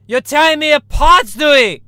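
high, loud anguished outburst; Tommy Wiseau. Acted. Tommy Wiseau crying out at Stewie in despair. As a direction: wail it like a wounded melodrama hero. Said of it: "Oh, hi, Mark".

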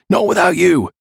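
high, urgent cry of loyalty; defiant shout. A narrator's reading. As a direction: shout back, refusing to leave. Shouted refusal during fight.

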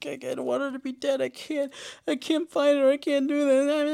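hammed-up, high-pitched mock crying; fake tears. Character pretending to mourn her missing friend, fake tearful. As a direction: plead in a high, badly faked sob. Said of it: "Misty is faking tears".

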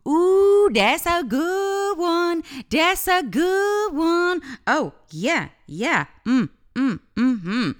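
high, slow savoring of a juicy line; mock relish. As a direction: relish it like a delicious treat. Savoring the ego's guilt trip.

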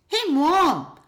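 put-on child calling for a parent's attention. Child calls out to mother.

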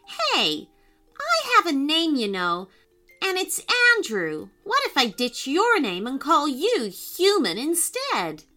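cross. Acted. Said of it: "looked at her crossly".

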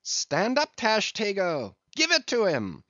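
high, urgent command to strike; high-pitched rousing shout; crying out. A narrator's reading. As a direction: call the harpooner to action in a high voice. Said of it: "the welcome cry was heard".

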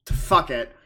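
ordinary delivery

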